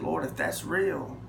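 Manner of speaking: softly praying to the Lord; quiet prayer. Retold or imitated. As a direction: pray it low and sincere. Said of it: "I said".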